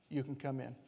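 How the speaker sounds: very soft, low reassurance; hushed, kind invitation; tender welcome. Retold or imitated. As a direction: open the door gently. Jesus welcomes in.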